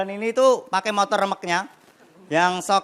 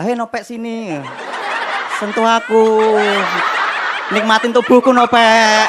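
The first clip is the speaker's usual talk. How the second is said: mock-seductive call, building louder; beckoning chicken; 3 dB louder than usual.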